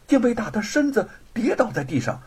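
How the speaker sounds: ordinary delivery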